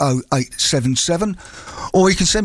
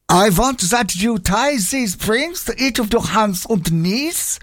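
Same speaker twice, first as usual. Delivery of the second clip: put-on higher, slow pantomime German accent; 5 semitones higher, slower than usual. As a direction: ham up the German client. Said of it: "pantomime German accent".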